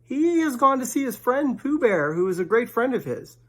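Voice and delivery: quick fussy rabbit answering; Rabbit's voice. Acted. Rabbit pretends Pooh is away, in a high put-on voice.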